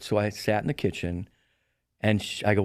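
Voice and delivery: ordinary delivery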